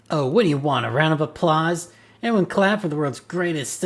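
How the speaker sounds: sarcastic retort. Acted. Detective mocks the partner's wordplay, sarcastic and loud.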